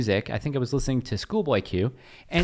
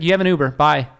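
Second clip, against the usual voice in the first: mock curt dismissal; 6 semitones higher, 8 dB louder than usual. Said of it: "I was like".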